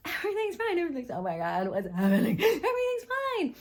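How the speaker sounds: high, laughing panic behind fake calm; forced everything's fine. Shown as a demonstration. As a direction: swing between calm and panic.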